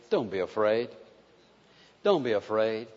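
gentle Jesus voice. Acted. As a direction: hush it like comforting a frightened friend. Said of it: "in that same old voice".

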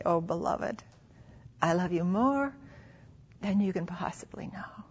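soft, hushed words fading to a whisper; tender reassurance. Acted. God answering someone who feels unloved, tender hushed reassurance. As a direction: voice God's love gently, ending almost whispered. Voices God's tender reply to believer.

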